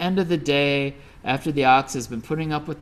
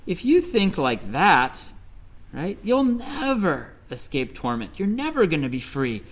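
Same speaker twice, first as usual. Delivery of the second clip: very high What? What?, then a higher, exasperated plea; the sprite can't believe what she's hearing; astonished sprite; 5 semitones higher than usual. Acted. Forest sprite answering the patient ox, high and incredulous. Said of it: "The forest sprite said".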